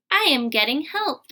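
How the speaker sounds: ordinary delivery, nothing marked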